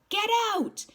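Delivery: loud high shout; urgent yell. Acted. Johnny shouting at Ponyboy to jump out the window. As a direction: shove him out with your voice.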